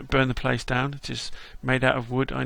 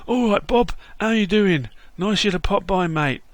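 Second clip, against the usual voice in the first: lightly voiced cheery London geezer, a bit higher; London Bob; 5 semitones higher, 4 dB louder than usual. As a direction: greet him like a chirpy London mate.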